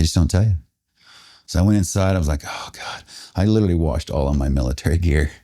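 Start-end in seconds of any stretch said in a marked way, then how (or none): 2.5-3.0 whispered sigh of words; weary exclamation. Retold or imitated. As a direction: let it out softly on the breath, acted. Exhausted relief once inside barracks.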